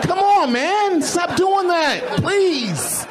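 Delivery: plead, slow and high, stop it; whiny pleading. Acted. Begging the magician.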